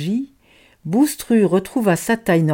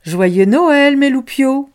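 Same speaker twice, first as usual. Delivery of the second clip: bright, louder holiday greeting; cheerful big sister; 6 semitones higher, 5 dB louder, slower than usual. Acted. Older girl wishing the children happy Christmas, louder and lifted. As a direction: greet the little ones warmly and brightly. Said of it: "Puis elle ajouta".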